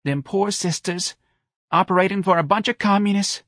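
high, plaintive lament; sad. A narrator's reading. Mrs Riley laments the poor nuns, sad. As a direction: feel sorry for them. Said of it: "Missus Riley said sadly".

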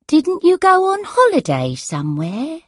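put-on puzzled, high-pitched old mother; confused elderly mother. A narrator's reading. Muddled mother asks daughter about going away, puzzled. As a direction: question her in a high mother's voice.

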